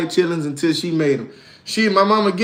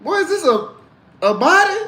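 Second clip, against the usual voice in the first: high, rising disbelief; high shocked kid asking what's in the pot; 9 semitones higher than usual. Retold or imitated. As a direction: squeak it out, horrified. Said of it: "I was like".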